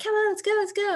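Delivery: put-on high, eager kids calling to hurry up; impatient kids. Kids urging their mom into the car, high and eager. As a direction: chirp it like kids eager to leave.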